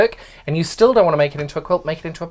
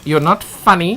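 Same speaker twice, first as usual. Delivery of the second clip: put-on thick South African accent, deadpan; 3 semitones higher, 6 dB louder than usual. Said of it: "In my thick South African accent".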